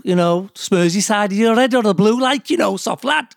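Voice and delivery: high, hammed-up Scouse; Liverpool accent. Doctor shows off his Liverpool accent, exaggerated Scouse. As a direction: do a broad Scouse voice, pitched well up. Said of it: "do the Liverpool".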